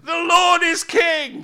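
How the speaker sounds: high, slow, drawn-out victory cry; triumphant shout. Real, not acted. Preacher celebrating the crossing to the congregation, triumphant shout. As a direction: preach it at full force.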